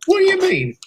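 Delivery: high, indignant disbelief; shocked question. Acted. Engine accused over a joke, shocked. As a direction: jump up in pitch, affronted.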